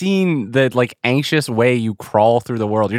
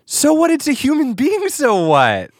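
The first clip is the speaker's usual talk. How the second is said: high-pitched indignant disbelief, dropping at the end; incredulous protest; 13 semitones higher than usual. Real, not acted. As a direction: squeal it as a teasing challenge. Co-host indignantly challenges the excuse.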